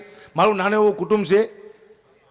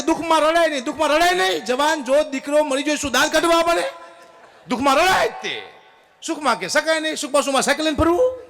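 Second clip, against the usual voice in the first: hammed-up indignant retort, much higher; mock outrage; 7 semitones higher than usual. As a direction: shout the line back in disbelief. Comedian shouts back at the hymn.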